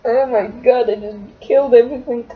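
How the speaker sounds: usual speaking voice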